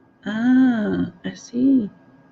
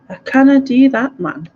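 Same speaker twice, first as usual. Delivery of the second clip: emphatic Scottish example, louder and higher, dropping on the last word; northern Scottish dialect; 6 semitones higher, 6 dB louder than usual. Shown as a demonstration. Showing how they talk north of Glasgow, emphatic Scottish accent. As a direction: throw it out loudly in northern Scots. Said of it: "That didn't sound Scottish at all".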